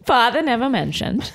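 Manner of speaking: put-on Boston accent. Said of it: "sorry about my Boston accent".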